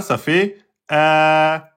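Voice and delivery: slow, held "a" from the throat; sustained a. Shown as a demonstration. Teacher demonstrating the long a to students. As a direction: stretch the "a" out. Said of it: "si je prends avec la hamza".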